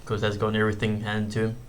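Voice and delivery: ordinary talk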